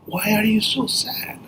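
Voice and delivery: high, soft bird voice; soft, high-pitched storybook bird; shorebird. Acted. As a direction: ask gently as a little bird. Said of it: "And the bird goes".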